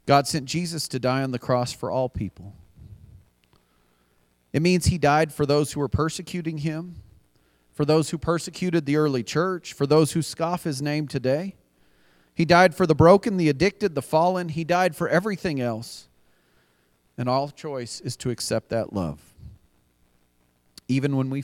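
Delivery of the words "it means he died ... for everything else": louder, pressing preacher build; rising sermon appeal. Acted. Pastor urging the congregation, building preaching. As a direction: lift it like an altar call. Preacher listing who Jesus died for.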